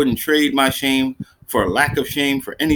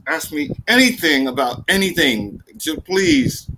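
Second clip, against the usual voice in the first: pleading snap; 4 semitones higher than usual. Retold or imitated.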